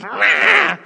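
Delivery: put-on high, loud toddler monster roar; gremlin roar. Tired toddler who missed his nap, gremlin roar. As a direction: roar like a wild little gremlin. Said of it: "he turns into this little gremlin".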